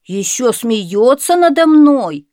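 slow, loud indignant outburst; hurt hunter protesting; offended. A narrator's reading. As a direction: take it as an insult. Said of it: "сказал охотник".

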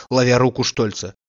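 plain reading voice

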